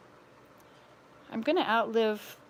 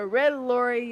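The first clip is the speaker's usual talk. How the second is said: laughing; 2 semitones higher, 6 dB louder, slower than usual. Real, not acted. Laughs mid tongue twister.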